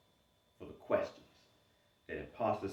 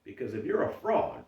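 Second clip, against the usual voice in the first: usual speaking voice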